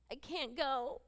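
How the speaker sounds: high, whining panic; freaking out. Retold or imitated. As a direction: plead in rising, high-pitched distress. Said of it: "He's, like, freaking out".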